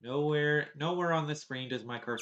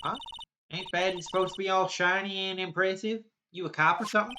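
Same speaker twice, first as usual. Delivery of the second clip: lightly voiced angry southern woman scolding; a hint of a riled-up country gal; 5 semitones higher, 10 dB louder than usual. Southern woman scolding the kids over ruined film, riled.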